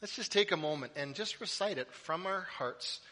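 ordinary talk

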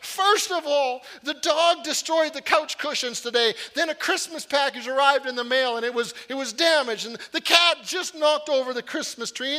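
hammed-up tearful wife listing her woes; mock sobbing wife. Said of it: "she bursts into tears, just sobbing".

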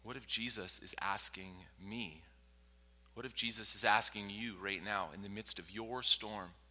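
ordinary delivery

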